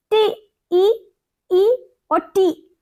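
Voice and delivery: slow high drawn-out टी, ई sounds; vowel sounds. Shown as a demonstration. Teacher isolating vowel sounds for her students. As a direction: stretch each vowel sound, ई, slowly. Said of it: "vowel sound किस तरह से आ रहा है".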